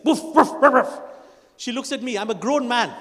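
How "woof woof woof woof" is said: loud barking call; wife barking the dog in for dinner, loud. Retold or imitated. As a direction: bark out woof to call the dog in. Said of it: "My wife calls Caesar for dinner like this".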